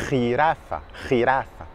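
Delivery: "jirafa" with the j scraped in the throat; jota espagnole. Shown as a demonstration. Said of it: "re avec la gorge". (« jirafa » avec un j guttural)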